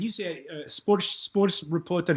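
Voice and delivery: high Scottish reporter voice, slowing down; Scottish sports reporter, pitched high; Ian McGregor voice. Pretend. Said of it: "his name is Ian McGregor".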